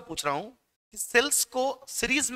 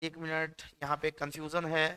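quiet self-mutter; 4 semitones lower, 8 dB quieter than usual. Real, not acted. Teacher unsure of formula.

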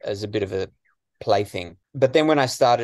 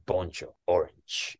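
deadpan Poncho line; 3 semitones lower, 5 dB quieter, slower than usual. Retold or imitated. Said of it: "just looking at the camera and saying".

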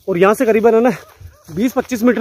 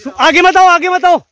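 urgent shout; 7 semitones higher, 5 dB louder than usual. Real, not acted. Warning others to stay back.

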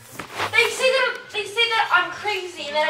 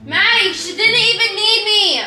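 slow, loud and exasperated; loud mock complaint; 4 semitones higher, 9 dB louder, slower than usual. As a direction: belt it out, annoyed and slow. Sister mocking Maddie again, louder.

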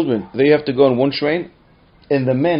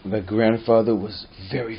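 ordinary talk

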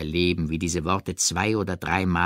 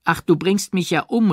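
cry out; 10 semitones higher, 4 dB louder than usual. A narrator's reading. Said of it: "rief sie aus".

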